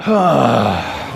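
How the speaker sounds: long audible sigh out; warm-up exhale sigh; loud sigh out. Shown as a demonstration. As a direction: breathe out with a big open sigh. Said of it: "sigh out".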